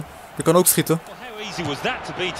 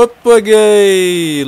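loud, very slow hoppakee; stretched gleeful hoppakee; drawn-out cheer; 6 semitones higher, 7 dB louder, much slower than usual. Real, not acted. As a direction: savour it as a triumph. Celebrating a goal.

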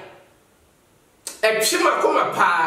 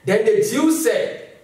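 ordinary talk

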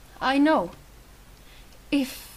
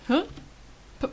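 normal talking voice

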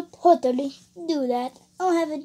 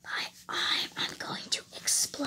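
whispered, halting build-up to bursting; strained whisper; 11 dB quieter than usual. Acted. Warning that he's going to explode, in a strained whisper. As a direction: whisper it haltingly, drawing out the last word.

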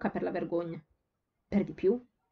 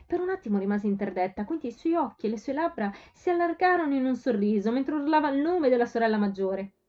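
usual speaking voice

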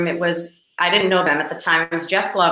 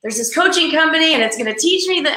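excited insistence; 5 semitones higher, faster than usual. Retold or imitated. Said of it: "I said".

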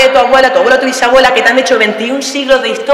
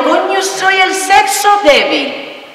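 slow, high self-mocking whine; hammed-up helpless lament; mock weak sigh; 9 semitones higher, much slower than usual. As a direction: play the fragile damsel, drawn out. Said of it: "yo me levanto y digo".

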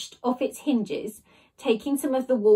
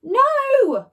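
very high, loud scream; 14 semitones higher, 8 dB louder than usual. A narrator's reading. Jemima screaming as Ned gets away, high and loud. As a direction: howl as he escapes. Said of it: "screamed Jemima".

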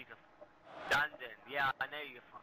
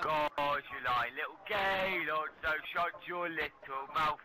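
slow, loud, drawn-out insult; mad; 3 semitones higher, 4 dB louder, slower than usual. Real, not acted. Gamer telling the taunting player to shut up, mad. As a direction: stretch the insult out angrily. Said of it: "Why are you mad".

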